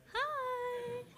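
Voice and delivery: drawn-out high greeting to the camera; high slow hi. Real, not acted. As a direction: stretch a squeaky hi. Waving at the stream camera.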